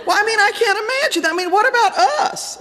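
put-on high-pitched, outraged kid protest; indignant child. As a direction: plead your case like an offended twelve-year-old. Acted child protesting to father.

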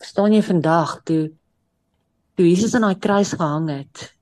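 ordinary talk

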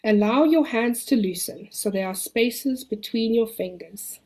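calm the listener, long pauses. Acted. Leads a relaxation exercise.

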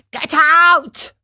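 loud, high threatening shout; threatening cry. Acted. Fisherman threatening the hungry dog, loud angry shout. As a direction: drive the dog off with a yell. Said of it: "cried the fisherman threateningly".

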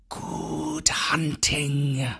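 slow, soft grim whisper. A narrator's reading. Kaa parting from Bagheera, grim slow whisper. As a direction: send him off grimly under breath. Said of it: "said Kaa grimly".

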